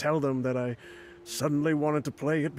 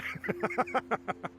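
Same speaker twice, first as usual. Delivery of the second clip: smug laugh; 9 semitones higher, 4 dB quieter than usual. Acted. Laughs at own boast.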